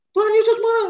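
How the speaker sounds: Tuhan Yesus shouted high and loud; excited alarm. Acted. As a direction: sound the alarm to the kids: Tuhan Yesus marah!. Children told Jesus is angry. (teriak kaget, tinggi dan keras)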